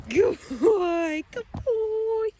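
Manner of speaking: high, drawn-out praise for a dog; excited pet-owner voice. Real, not acted. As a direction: draw it out high for the pup.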